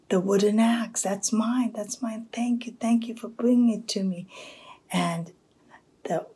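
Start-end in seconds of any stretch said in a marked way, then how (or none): 0.1-4.3 high, eager, grateful gush; acted joy, pitched up; overjoyed woodcutter. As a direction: pour out thanks in a raised voice. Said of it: "the woodcutter is so happy".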